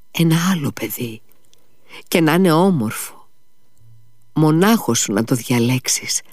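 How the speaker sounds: put-on high, tender child's voice; a dying boy soothing his father; sick child comforting. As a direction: son begging his father not to cry, tenderly.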